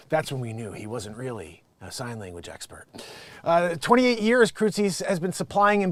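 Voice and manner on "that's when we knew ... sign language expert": soft whispered punchline. Real, not acted. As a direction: whisper the aside. Whispered joke aside.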